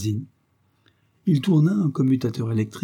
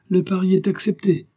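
high-pitched amused exclamation, no real laugh; gleeful cry; 8 semitones higher, 4 dB louder than usual. A narrator's reading. Captor takes the prisoner's bet, crying out in amusement. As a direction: call it out with mild glee. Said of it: "cria Thompson en s'esclaffant".

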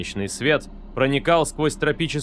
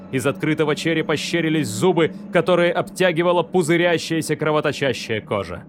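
ordinary delivery

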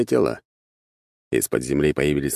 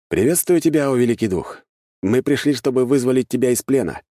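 raised-pitch ceremonial address; grand greeting; 7 semitones higher than usual. A narrator's reading. Hero hailing the monster as great spirit, grand proclamation. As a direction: proclaim it to a mighty being.

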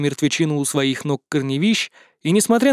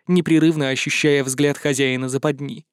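usual speaking voice

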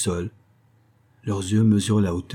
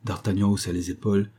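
usual speaking voice

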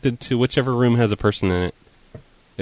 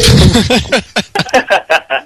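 loud laughter; 8 semitones higher, 4 dB louder than usual. Real, not acted. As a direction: burst of laughing. Laughing at prank.

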